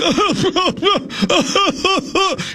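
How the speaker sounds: sudden high burst of laughter. Real, not acted. Laughs at heave cry.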